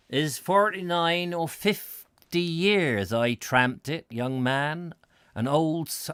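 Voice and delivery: a put-on rustic West Country accent, slowed; old Devon pedlar, West Country accent, lightly done. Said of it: "I don't know what accent I've gone into there".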